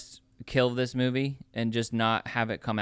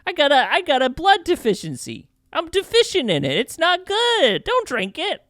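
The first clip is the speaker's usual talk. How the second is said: much higher, slow whining New York tough; cartoon New York accent; 12 semitones higher, 4 dB louder than usual. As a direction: beg off a bloodsucker in a hammed-up New York whine. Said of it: "super cartoon New York accent".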